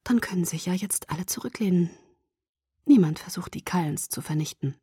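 soft whisper, fading at the end; quiet, whispered relief. A narrator's reading. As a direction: keep it hushed, half-voiced. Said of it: "flüsterte ich".

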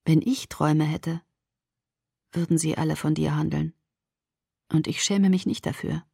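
soft, close confession; whispered into ear. A narrator's reading. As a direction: confide it softly into the ear. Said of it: "flüsterte er mir ins Ohr".

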